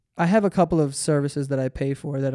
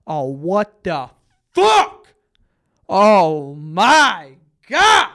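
imitating a raging gamer; 7 dB louder, much slower than usual. Imitating his roommate dying in Halo, raging yell. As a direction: lose it after dying in the game. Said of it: "he would say the most crazy shit".

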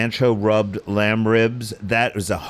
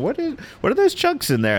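puzzled. Retold or imitated. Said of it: "I was sitting there going".

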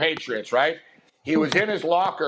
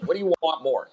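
usual speaking voice

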